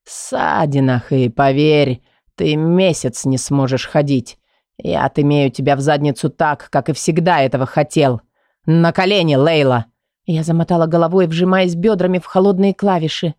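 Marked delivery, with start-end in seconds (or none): 0.0-9.9 low menacing Kai. Acted. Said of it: "Его угрожающий шёпот".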